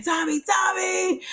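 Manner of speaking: high, slow call across the warehouse. Retold or imitated. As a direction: call his name out, worried. Ghost calling out for Tommy.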